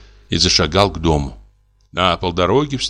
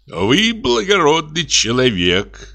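higher and slow, a moved exclamation with a rising end; croaked out warmly; 9 semitones higher, slower than usual. A narrator's reading. Notary shakes the technician's hand, slow lifted praise. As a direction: praise him with drawn-out, lifted gratitude. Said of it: "прокряпел нотариус".

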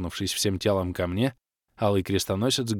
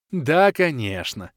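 slow wry sarcasm; sarcastic smirk; 6 semitones higher, 4 dB louder, slower than usual. A narrator's reading. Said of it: "усмехнулся я". (с усмешкой, медленно)

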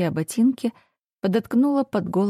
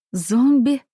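shocked question, higher and louder; surprised; 6 semitones higher, 6 dB louder than usual. Acted. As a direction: recoil at the word Зомби. Shocked at zombies.

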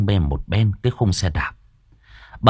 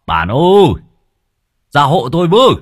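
higher, louder call to the house; 6 semitones higher, 6 dB louder than usual. A narrator's reading. Husband calling his wife from the gate. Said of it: "ông hô to".

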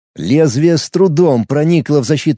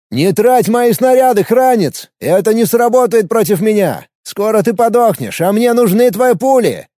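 mocking retort; 7 semitones higher than usual. A narrator's reading. Alex taunts.

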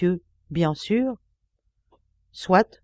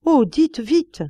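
eager plea; 8 semitones higher, 5 dB louder, much slower than usual. Acted. Toinon begs the policeman.

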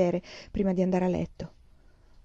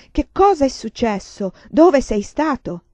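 louder, slower, insistent repeat; second try, raised and drawn out; urgent pleading; 5 semitones higher, 8 dB louder, slower than usual. A narrator's reading. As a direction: demand an answer now, pressing harder. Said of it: "Di nuovo non ricevette risposta".

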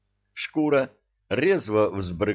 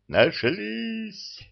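joyful yell; 13 semitones higher than usual. Acted. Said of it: "радостно завопил он".

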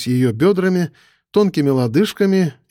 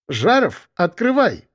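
called through a closed door, high; 5 semitones higher, slower than usual. Acted. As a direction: call out from behind a door. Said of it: "послышался голос из-за двери". (голос из-за двери)

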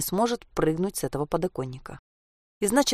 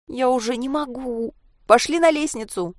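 whining; 6 semitones higher than usual. A narrator's reading. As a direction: whine that you can't hold on. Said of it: "заныла Анька". (жалобно ныть)

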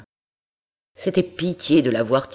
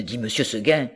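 usual speaking voice

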